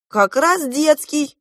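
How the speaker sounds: high, loud, drawn-out contradiction; objecting. A narrator's reading. Younger prince contradicting Klop, objecting. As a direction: insist emphatically against him. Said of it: "возразил младший принц".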